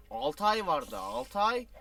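groaning that it's a long wait; exasperated exclaim. Real, not acted. Gamer telling viewers the wait for the season is six months, exasperated. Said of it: "dismay at six month wait".

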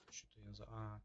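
usual speaking voice

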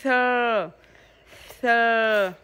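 "Third," with a breathy th and a clear er vowel; model "third". Shown as a demonstration. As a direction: stretch "third." and blow the th. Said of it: "sao lại xì xong thôi thế". (mẹ đọc mẫu "third." thổi hơi chữ th)

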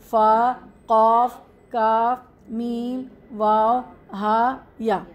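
slow, drawn-out letter names; letter recitation. Shown as a demonstration. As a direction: list the Arabic letters one at a time, stretching each.

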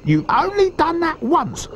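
high-pitched, scornful little croc; high, dismissive character voice; snorted retort. A narrator's reading. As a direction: scoff way up high, as a small croc. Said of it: "snorted the not-so-big one".

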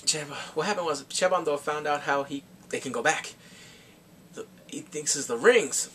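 ordinary delivery

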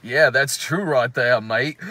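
a lazy fake Australian drawl ending on mate; bad Australian accent. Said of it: "Stephen Baldwin goes like".

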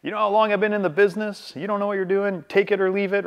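mock the pushy veteran agent; assertive bully. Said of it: "there's this assertive bully kind of tactic".

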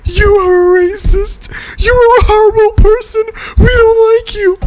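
shrieky falsetto mock outrage; very high, hammed-up indignant whine; outraged fan parody. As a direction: squeal it like a furious offended fan. Mocking angry Swiftie commenters.

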